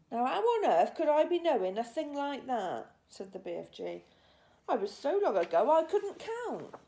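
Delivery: put-on a very old giant puzzling over himself. A narrator's reading. BFG musing on age.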